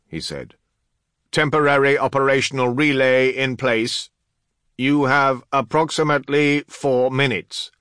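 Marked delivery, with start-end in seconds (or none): 1.3-7.7 high, loud, declaimed status report; Master of Artifice. Acted. Artificer giving the commander a deadline, high loud announcement. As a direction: project it evenly in a raised official voice.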